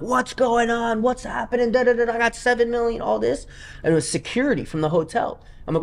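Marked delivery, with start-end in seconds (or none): 0.0-3.5 high, frantic mock yelling; hammed-up alarm, voice cracking high; retold panicked scream. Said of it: "I just start screaming".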